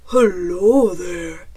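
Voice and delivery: loud, low, slow bear voice; storybook bear. A narrator's reading. Bear greets Chicken by the fire, big bear voice. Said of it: "said Bear".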